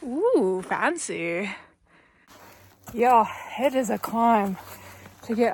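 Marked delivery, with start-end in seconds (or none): none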